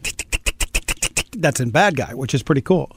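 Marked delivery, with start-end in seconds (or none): 0.0-1.3 soft, voiceless tongue ticks; quiet run of clicking ticks; ticking sound imitation. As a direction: imitate a rapid mechanical ticking. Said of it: "and the tick".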